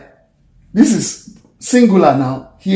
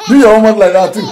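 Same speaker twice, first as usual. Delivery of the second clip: louder, higher, through real laughter; laughing; 4 semitones higher, 7 dB louder, much faster than usual. Real, not acted. Teacher tells students to copy the mouth shape, laughing. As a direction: laugh as you give the instruction.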